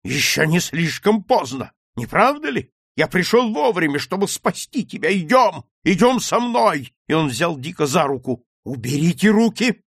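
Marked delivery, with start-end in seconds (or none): 0.0-6.9 high, loud fatherly appeal; pressing, raised-voice entreaty; urgent plea. Acted. Father urgently pleads with son. 8.6-9.8 loud, high protest; angry raised-voice order; sharp exclamation. Acted. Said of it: "воскликнул Дик".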